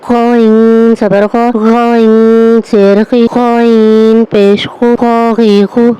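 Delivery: slow, gargled غ with throat buzz in غا; voiced غ. Shown as a demonstration. Teacher showing the buzzing غ against خ. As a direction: let the throat vibrate on غا, slowly. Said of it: "ہلکی سی گلے میں vibrate محسوس ہو".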